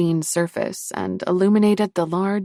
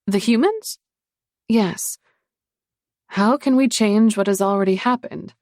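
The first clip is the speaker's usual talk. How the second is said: lightly voiced woman, a bit higher; lightly voiced woman, earnest; female voice; 5 semitones higher, 4 dB louder than usual. Female characters' dialogue.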